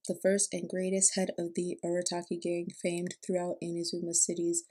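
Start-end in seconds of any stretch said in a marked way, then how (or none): none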